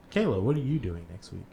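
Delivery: usual speaking voice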